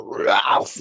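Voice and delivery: the name heaved up like vomit; mock retching. Schoolkid passing him in the hall, mock throwing up. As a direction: bark it out as if throwing up. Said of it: "like he was throwing up on me".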